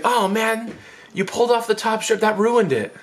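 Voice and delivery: high-pitched, whiny letdown; mock-aggrieved brother; disappointed complaint. Brother lamenting the spoiled design, high whine.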